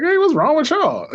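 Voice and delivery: bewildered and playful, high; puzzled question. Retold or imitated. Waking patient asking his crying family, high and puzzled. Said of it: "I'm like".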